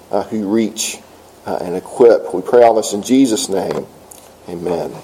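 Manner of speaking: prayer. Acted. Pastor praying aloud for the congregation, hushed and earnest. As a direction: speak it as a humble prayer to God.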